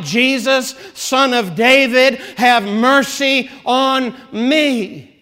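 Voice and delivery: loud, slow, desperate cry; a desperate beggar crying out for mercy. Retold or imitated. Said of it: "he begins crying out".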